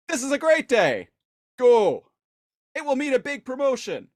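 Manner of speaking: high voice with loud shouted "Go"; a giddy henchman shooing them off; hammed-up eager guard. Guard giddily shooing, high with shouted go. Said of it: "the guy is just like".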